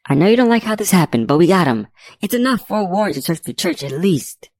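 high-pitched, urgent pleading. A narrator's reading. Investigator begging Agent Sparks to act on the evidence, pleading. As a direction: plead the case with rising pitch. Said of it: "I pleaded with him".

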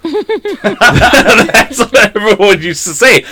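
real laughter running into the words; slow start, laughing through it. Real, not acted. Telling friends about coworkers' teasing, laughing.

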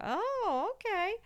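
high, loud mock realisation; exaggerated high acknowledgement; knowing reaction. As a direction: imitate listeners suddenly catching on. Imitating people's reaction to her boast.

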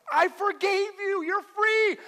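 very high, loud, drawn-out proclamation; acted emphatic shout. God answering an apologizing believer, acted emphatic shout. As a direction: shout forgiveness as an exasperated God.

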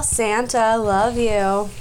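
ordinary talk

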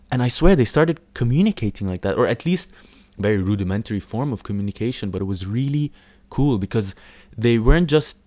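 ordinary talk

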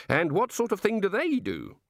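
innocent, measured wondering; curious, genial god. Acted. God asking wizards how it all works, innocently curious.

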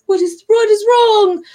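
high, loud puzzled question; retold stranger, high and loud. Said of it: "And he's like".

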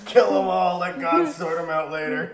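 loud, high mock war cry; mock battle cry. Player booming a mock war cry at the table. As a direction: bellow it like a soldier.

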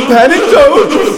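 "Panecdote!" yelled high, loud and stretched through laughter; loud slow laughing shout. Shown as a demonstration. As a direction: bellow "Panecdote!" like a game-show title, laughing.

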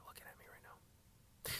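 hushed, barely voiced aside; whisper. Real, not acted. Driver being looked at confides to listeners, whispering. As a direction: whisper so the people nearby can't hear.

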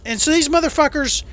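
ordinary talk

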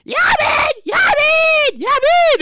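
high shouted calls of the name; calling out. Retold or imitated. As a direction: yell the name into the distance. Search party calling out.

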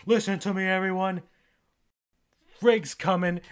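rally the crowd with godly force; commanding call. Retold or imitated. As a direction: Thor ordering everyone to clean up, booming. God commanding everyone.

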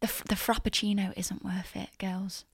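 put-on hushed aside to the girls; stage whisper to listeners. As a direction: lean in and whisper the advice.

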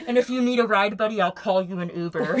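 loud, jokey mock offer; mocking judge voice. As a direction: ham up the smug judge. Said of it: "He's like".

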